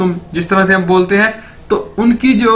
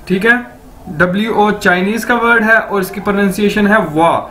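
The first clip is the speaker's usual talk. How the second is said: usual speaking voice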